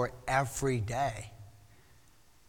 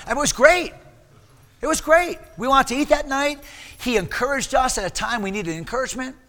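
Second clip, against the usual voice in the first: usual speaking voice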